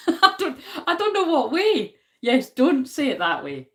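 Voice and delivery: high, loud laughing "I don't know what way"; laughing protest. Real, not acted. Vlogger laughing at a viewer's correction, loud. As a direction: laugh through it, flustered. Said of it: "laughing answer to comment".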